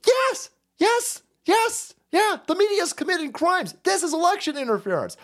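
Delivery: very high, insistent exclamation; emphatic retort. Real, not acted. Emphatic retort to the mocked objection.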